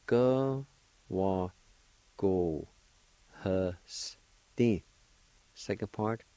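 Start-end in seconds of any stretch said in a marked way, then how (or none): 0.0-4.8 Go, wa, go, hu, s, di, each sound alone; syllable-by-syllable pronunciation. Shown as a demonstration. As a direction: sound out Go, wa, go, hu, s, di with pauses. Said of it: "First part is pronounced like this".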